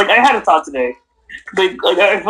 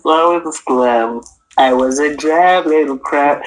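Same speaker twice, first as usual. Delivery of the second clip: low, slow Tamatoa voice; lightly voiced crab; 4 semitones lower than usual. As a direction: croon it like the giant crab. Singing Tamatoa's song.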